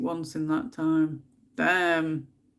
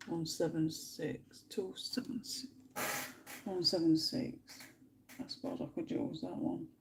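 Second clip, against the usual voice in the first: much softer, half-whispered counting; muttering to herself; 11 dB quieter than usual. Real, not acted. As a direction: mutter the numbers under your breath while choosing colours. Muttering to herself while colouring.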